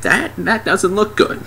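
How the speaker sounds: high, hesitant comic voice; puzzled onlooker. Retold or imitated. Guy studying the blue lines, mock puzzled. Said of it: "just looking at it like".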